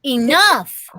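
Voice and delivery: loud, high sharp command. A narrator's reading. Mother commanding her children at dinner, loud and sharp. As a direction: cut them off with one word. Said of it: "Commanded my mom".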